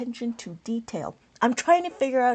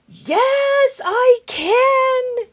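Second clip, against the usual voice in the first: excited yes; 12 semitones higher, 3 dB louder, much slower than usual. Real, not acted. Thrilled reply to viewer's suggestion.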